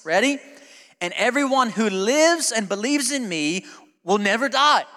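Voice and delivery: high, loud, stretched preaching; full-force preaching. Acted. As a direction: preach it out, stretching key words.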